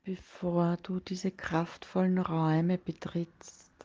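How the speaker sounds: ordinary talk, nothing marked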